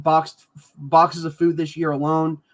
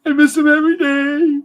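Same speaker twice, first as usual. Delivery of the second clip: crying; 6 semitones higher than usual. Real, not acted. Father crying over the children he can't see. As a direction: voice breaking, high and tearful. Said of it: "Sorry for crying".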